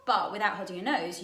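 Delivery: ordinary delivery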